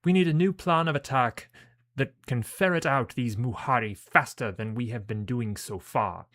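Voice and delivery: forceful British officer giving orders; British-accented character. Acted. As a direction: put on a commanding British accent. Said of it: "accent became that".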